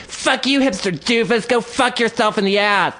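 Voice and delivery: high-pitched mock screech; mimicked parting shot. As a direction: fling insults in a put-on high voice. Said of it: "like a little parting shot".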